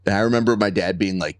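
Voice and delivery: ordinary talk, nothing marked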